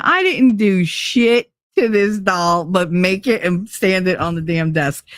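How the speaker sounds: plain reading voice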